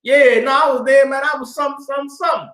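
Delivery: flustered lie. Retold or imitated. Lying, caught out.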